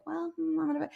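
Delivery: high, soft musing; mock unbothered. Imitating Amber standing there in danger, mock unbothered. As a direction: imitate a friend dithering calmly in danger. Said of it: "she just stands there and she's like".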